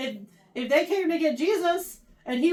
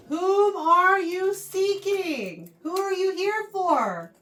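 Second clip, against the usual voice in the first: higher, louder, slow challenge; challenging; 6 semitones higher, 4 dB louder, slower than usual. Acted. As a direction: dare them to answer, drawn out. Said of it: "He's basically challenging them".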